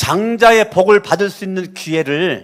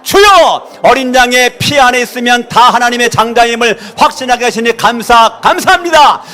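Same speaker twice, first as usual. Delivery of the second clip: shouted prayer, high and loud throughout; fervent loud prayer; 5 semitones higher, 7 dB louder than usual. Real, not acted. Pastor leading a shouted group prayer. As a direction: pour out each line as a fervent cry.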